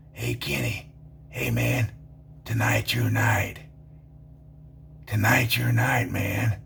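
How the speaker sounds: hushed, low whisper with a sinister edge; secretive whisper. Retold or imitated. Bar acquaintance leaning in, whispering an ominous tip. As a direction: lean in and whisper like you know something.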